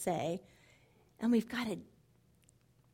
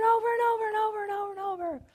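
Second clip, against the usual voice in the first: fast, higher tumbling run of words; rushed repetition; 10 semitones higher, 4 dB louder, much faster than usual. Acted. Speaker imitates a mind going over lies endlessly, rushing higher. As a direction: rattle it off like a thought on loop. Mimicking endless mental replay.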